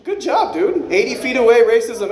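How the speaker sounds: high, amused sarcastic praise; sarcastic congratulation; mock impressed. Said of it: "I was impressed".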